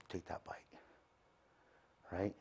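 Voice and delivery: hushed tempting whisper. Retold or imitated. The enemy whispering to a believer, tempting hush. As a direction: breathe it like a tempter.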